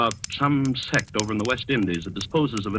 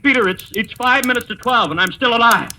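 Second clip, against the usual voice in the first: giddy defiance; 9 semitones higher, 7 dB louder than usual. Acted. Count toasts, defiant, near midnight.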